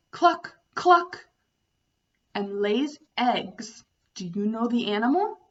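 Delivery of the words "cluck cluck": high, loud clucks; playful chicken sound for kids; hen clucking. Shown as a demonstration. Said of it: "The next animal goes cluck".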